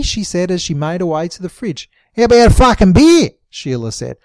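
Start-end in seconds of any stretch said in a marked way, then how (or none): 2.2-3.3 loud, blunt demand; brash demand. A narrator's reading. Sheila to Beryl, demanding a beer loudly. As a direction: push for the beer.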